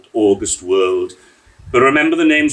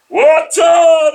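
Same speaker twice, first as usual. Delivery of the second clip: much higher, much louder gleeful shout; 11 dB louder than usual. A narrator's reading. Drunk men spotting the river, loud joyful shout. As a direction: yell it out at the sight of the river. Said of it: "they shouted".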